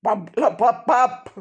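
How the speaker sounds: loud, high robber's interruption; dismissive shout. A narrator's reading. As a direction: cut her off with barked syllables. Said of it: "rief der Räuber Hotzenplotz".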